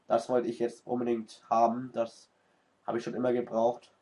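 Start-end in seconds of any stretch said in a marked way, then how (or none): none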